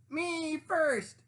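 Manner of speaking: high, loud greedy shriek; shrieking pig. Acted. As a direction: shriek it at a full gallop. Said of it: "Pinkerton shrieked".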